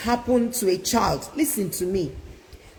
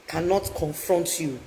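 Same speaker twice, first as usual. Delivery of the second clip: usual speaking voice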